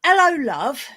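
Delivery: a lightly done London greeting. Shown as a demonstration. British guest demonstrating the London hello. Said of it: "a way of saying hello that's used by Londoners".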